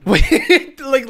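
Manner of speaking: high loud laugh into "wait"; cracking up in disbelief. Real, not acted. Cracks up at the clip.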